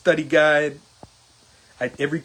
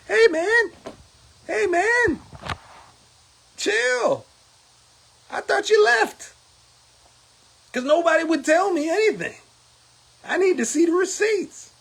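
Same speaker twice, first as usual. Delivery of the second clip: high, raised calls of hey man, chill; calling out; 13 semitones higher than usual. Retold or imitated. To [name] over the receipts, high excited calling out. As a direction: flag him down, half exasperated.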